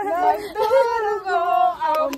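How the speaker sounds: loud, slow, hammed-up lament, half-sung; drama. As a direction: overplay the heartbreak with a laugh in it. (madramang daing, malakas at mabagal)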